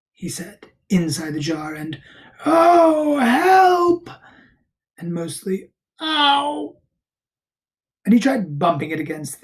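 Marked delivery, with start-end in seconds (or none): 2.5-4.1 loud, high wail for help; storybook bear wailing to be freed; cry for help. A narrator's reading. Pooh stuck, calls for help. 6.0-6.8 high, loud acted "ow"; storybook cry of pain; yelp of pain. A narrator's reading. Pooh hurting inside the jar.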